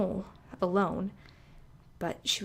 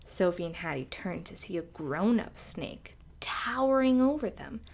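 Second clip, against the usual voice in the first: ordinary delivery, nothing marked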